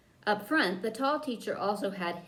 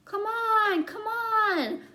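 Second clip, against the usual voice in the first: calling down; 13 semitones higher, 4 dB louder, much slower than usual. A narrator's reading. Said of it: "a voice drifted downward".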